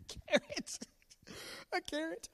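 very high squeaked answer; squeaky punchline. Acted. Dad squeaking the parrot punchline.

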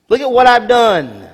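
proud king boast. Acted. Preacher voicing Nebuchadnezzar bragging, loud proud boast.

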